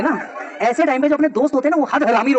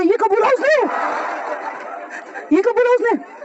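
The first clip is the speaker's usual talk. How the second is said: shocked disbelief; 11 semitones higher than usual. Retold or imitated. His shocked disbelief retold.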